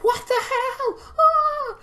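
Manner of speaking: high mock scream, stretched out; hammed-up child scream; imitated scream. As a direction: shriek like a little kid. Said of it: "hear her screaming".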